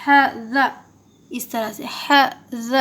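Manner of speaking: ح، ز each sounded apart from its proper point; careful articulation. Shown as a demonstration. Teacher showing learners the makhraj, careful articulation. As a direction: pronounce ح، ز distinctly, one by one. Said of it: "مخرج سے ادا، ادا کرے".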